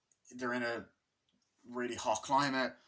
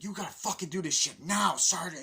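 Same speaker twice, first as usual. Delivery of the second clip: mock shouting at sergeant; 8 semitones higher, 7 dB louder than usual. Soldier yelling at sergeant.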